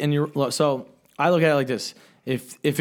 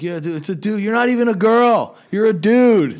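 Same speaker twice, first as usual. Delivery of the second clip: higher and louder mocking taunt; taunting yell; 7 semitones higher, 7 dB louder than usual. Taunting the girl, loud jeering. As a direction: jeer at her loudly. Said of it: "we're like".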